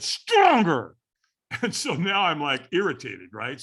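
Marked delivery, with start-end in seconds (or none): 0.0-1.0 loud, high coaching shout; acted-out trainer's demand; trainer urging harder. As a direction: push someone to give more. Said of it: "And he said".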